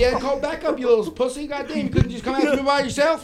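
mock guard taunt. Security guard taunting the teller.

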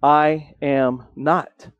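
a slow, soft I am not, each word drawn out; slow quiet denial. Acted. Peter denying who he is, slow and hushed. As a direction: Peter quietly shrinking from Jesus. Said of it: "And Peter's response".

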